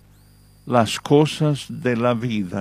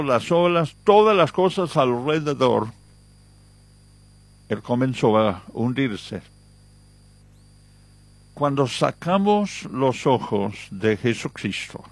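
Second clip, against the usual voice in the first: ordinary delivery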